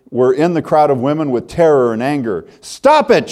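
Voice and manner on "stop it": put-on very high, loud furious child's scream. Girl screaming at soldiers hurting Jesus, high angry shriek. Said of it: "she screamed".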